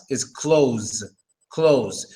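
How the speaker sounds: "close" said kloʊz, no th sound; "close" pronounced without the th; close not clothes. Shown as a demonstration. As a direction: say "close" exactly like close the door. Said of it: "It's spelled clothes, but it's just close".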